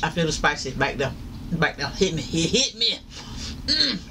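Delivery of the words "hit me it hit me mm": usual speaking voice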